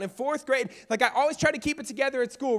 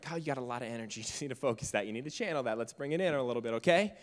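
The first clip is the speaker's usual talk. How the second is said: low, soft teacher voice, gently coaxing; calm the hyper kid down gently; 10 semitones lower, 6 dB quieter, faster than usual. Retold or imitated. Teacher calming energetic Kyle, low and soft. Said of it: "the teacher's like".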